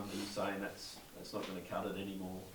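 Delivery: usual speaking voice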